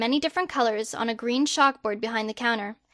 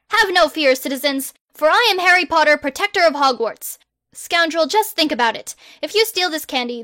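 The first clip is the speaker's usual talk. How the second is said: loud, higher, grandly righteous; self-styled superhero; 4 semitones higher, 4 dB louder than usual. Acted. Harry lecturing the robber on candy, heroic and loud. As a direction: lecture the crook like a caped hero.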